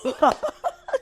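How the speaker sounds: a real laugh through welling tears; teary laugh. Real, not acted. Guest laughing through tears as host praises her. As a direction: laugh it off while tearing up.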